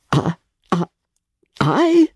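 halting, stumbling start; stammering. A narrator's reading. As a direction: trip over the words nervously. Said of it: "he stammered".

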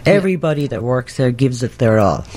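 ordinary talk, nothing marked

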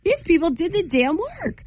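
high, disbelieving outburst; incredulous. Retold or imitated. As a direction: say it in shocked disbelief. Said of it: "I'm like".

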